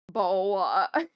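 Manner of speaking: teasing imitation of a British accent; mock British accent. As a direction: tease with an exaggerated British accent. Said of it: "when we said".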